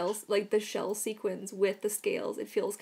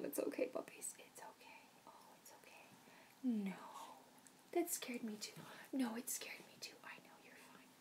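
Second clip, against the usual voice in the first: soft whispered reassurance; gentle hush; soothing whisper; 3 semitones higher, 15 dB quieter than usual. Real, not acted. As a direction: whisper comfort to the dogs. Whispering to scared dogs.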